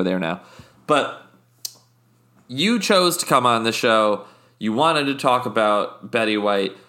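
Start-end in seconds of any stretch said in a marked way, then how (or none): none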